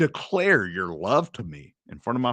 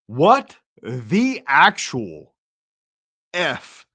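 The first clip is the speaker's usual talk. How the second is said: slow stunned; 6 dB louder, much slower than usual. Real, not acted. Stunned reaction to clip.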